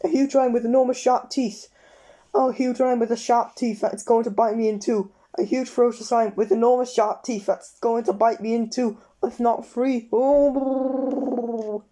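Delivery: high, breathless mounting terror; escalating panic. A narrator's reading. Mr Jelly imagining a huge lion, high escalating panic. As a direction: whip yourself into a frenzy of fear.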